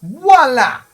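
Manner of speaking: high, loud building to voila; grand voila; triumphant. Real, not acted. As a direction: announce it like a magic trick. Triumphant airlock reveal.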